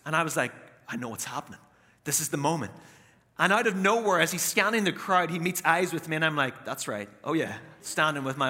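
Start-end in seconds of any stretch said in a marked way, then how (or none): 0.9-2.7 low, hushed inner voice; quiet certainty. Retold or imitated. Privately sure his moment has come. 6.6-7.6 low, soft, self-satisfied; understated cocky self-approval; quietly smug. Retold or imitated. Cocky reaction to being noticed.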